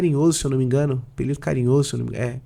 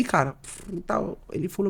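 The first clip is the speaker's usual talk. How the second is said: ordinary talk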